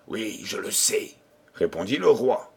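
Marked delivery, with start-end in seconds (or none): 0.1-1.1 soft, secretive admission; hushed. A narrator's reading. The king secretly admitting he knows the poisoner, hushed. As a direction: confide it softly. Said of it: "Mais parlez plus bas".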